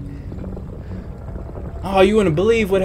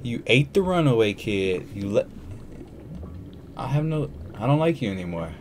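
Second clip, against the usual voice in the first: quiet dismay; 8 semitones lower, 12 dB quieter than usual. Real, not acted.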